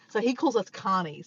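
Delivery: ordinary delivery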